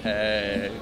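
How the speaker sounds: ordinary delivery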